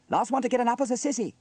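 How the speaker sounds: put-on high, fast, loud child's taunt; laughing taunt. A narrator's reading. James challenges Sarah to race, gleeful. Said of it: "laughed James".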